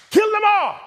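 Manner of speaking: high, loud shout; mock furious scream. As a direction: scream the curse at the top of the voice. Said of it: "that's how mad I was".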